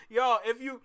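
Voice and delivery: ordinary talk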